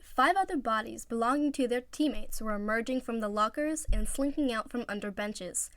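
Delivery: usual speaking voice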